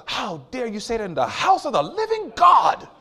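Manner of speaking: a hammed-up horrified shout; mock outrage. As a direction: play the scandalized church elder. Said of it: "I'm not like".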